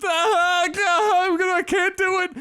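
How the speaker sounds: high-pitched wailing sob; hammed-up bawling through the words; mock crying. Ashamed student giving up on the exam, mock hysterical crying. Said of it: "crying her eyes out".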